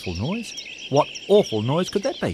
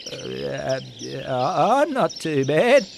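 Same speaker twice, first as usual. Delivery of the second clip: timid; much slower than usual. Acted. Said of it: "began Barney".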